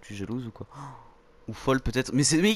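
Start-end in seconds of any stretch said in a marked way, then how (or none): none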